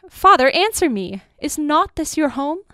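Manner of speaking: loud, high desperate appeal; urgent plea. A narrator's reading. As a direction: plead with father to answer. Child pleads with departing father.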